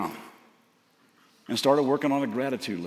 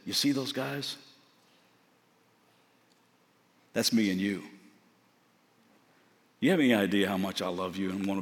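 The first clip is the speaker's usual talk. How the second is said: soft, lower, unhurried voice of God; hushed tender divine voice; God speaking tenderly; 4 semitones lower, 3 dB quieter than usual. Acted. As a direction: speak it gently, like a loving father. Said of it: "God spoke to my spirit and said".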